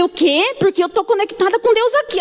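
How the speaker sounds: high excited exclamation. Real, not acted. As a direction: voice lifting with surprise. Excited retelling of the news. (empolgada, voz alta e aguda)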